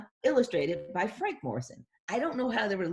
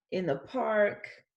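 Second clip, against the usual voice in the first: ordinary delivery, nothing marked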